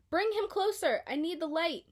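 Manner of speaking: high, loud urgent plea; urgent call. A narrator's reading. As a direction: call back in a hurry. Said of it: "Dory answered".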